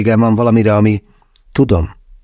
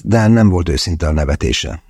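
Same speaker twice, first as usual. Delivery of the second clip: ordinary delivery, nothing marked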